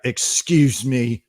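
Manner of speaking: prissy mock exit speech; mock indignant. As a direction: act offended and storm out. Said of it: "stand up and be like".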